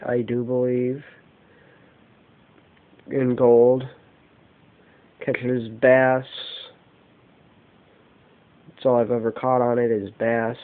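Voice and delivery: ordinary talk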